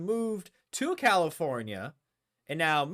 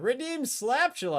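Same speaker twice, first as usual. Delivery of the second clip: Slaptula lifted high and sung out; playful raised call; higher announcement; 7 semitones higher than usual. Shown as a demonstration.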